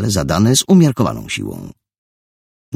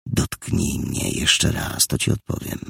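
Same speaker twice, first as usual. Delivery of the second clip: whispered threat; 3 semitones lower, 5 dB quieter than usual. Acted. Richard's quiet final warning.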